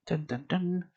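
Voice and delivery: usual speaking voice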